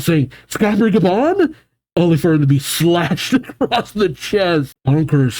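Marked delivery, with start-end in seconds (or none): 0.5-1.5 much higher shocked voice; freaked-out gasp. Retold or imitated. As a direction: squeak the name in disbelief. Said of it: "Summers freaks out".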